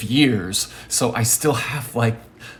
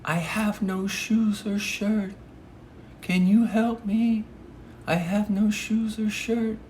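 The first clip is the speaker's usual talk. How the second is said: imitated high-pitched plea; really high voice; 10 semitones higher, 4 dB quieter than usual. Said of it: "he had a really high voice".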